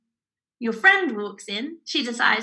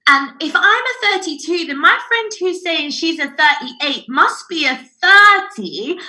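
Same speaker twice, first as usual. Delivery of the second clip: eureka moment; 5 semitones higher, 7 dB louder than usual. Retold or imitated. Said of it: "has a eureka moment".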